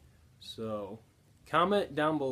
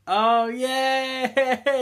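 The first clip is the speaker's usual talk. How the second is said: higher, louder cheer through laughter; laughing cheer; 11 semitones higher, 12 dB louder, much slower than usual. Real, not acted. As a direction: cheer while cracking up. Cheering as she gags.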